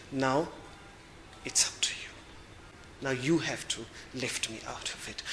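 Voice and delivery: fading down to a near whisper, letting go; quiet surrender. Retold or imitated. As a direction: hand it over softly, almost breathless. Voicing surrender to the divine.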